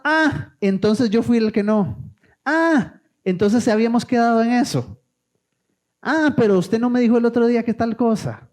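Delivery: high, clipped ahs of mock indignation; angry sarcastic retort. Arguing partner firing back angry sarcastic questions, high-pitched. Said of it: "Y entre más enojado".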